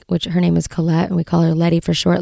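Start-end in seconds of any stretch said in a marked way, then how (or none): none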